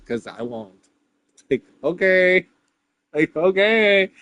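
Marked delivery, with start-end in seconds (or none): 1.5-4.1 loud, high sarcastic okay; a mock-exasperated okay. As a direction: throw the okay back with scorn.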